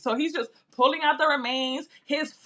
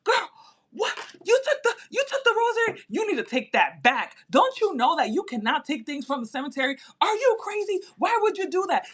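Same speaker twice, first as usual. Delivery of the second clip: much higher, alarmed mock scolding; imitating panicked mom; 7 semitones higher than usual. Mom scolding daughter for taking the rosary, high and panicked. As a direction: shriek at a reckless daughter, half laughing.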